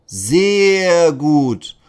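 loud hearty praise from the officer; praising policeman. Acted. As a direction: praise the class warmly and loud. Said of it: "lobt Patrick".

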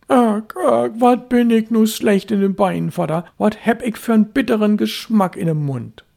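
put-on high, loud boyish complaint. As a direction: whine about the bad taste like a sick child. Boy voice, sick.

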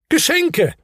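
very high-pitched exclamation; exasperated cry. A narrator's reading. Hemul overwhelmed by presents, high exasperated cry. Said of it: "rief der Hemul aus".

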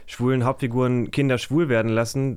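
ordinary delivery

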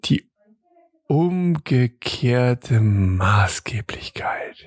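slow pondering whisper. Real, not acted. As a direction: draw the words out in a whisper. Pondering the tax question. (langsam nachdenklich geflüstert)

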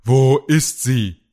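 slow, loud, burning demand; angry demand. Acted. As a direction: force each word out in rage. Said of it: "Kalles Wut loderte wie ein Feuer".

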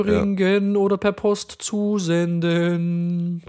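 high, very slow intoned request; drawn-out, hammed-up formal wish; mock-ceremonial plea. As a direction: intone it like a playful incantation.

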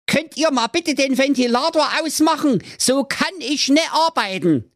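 sehr hohe, quäkende Hexe; Hexenstimme. Acted. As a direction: genervte alte Hexe. Hexe spricht. (hohe Hexenstimme)

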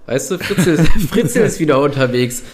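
words squeezed out through giggles; trying to talk while barely holding in laughter; stifled giggles. Real, not acted. Giggling while telling dark joke. (unterdrücktes Kichern)

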